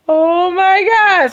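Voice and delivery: slow, loud, high Oh my God; stunned joy. Retold or imitated. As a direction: drag it out, overwhelmed with shock. Seeing the positive test.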